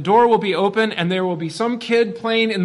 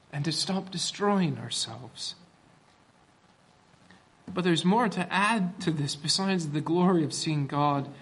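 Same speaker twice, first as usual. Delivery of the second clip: ordinary delivery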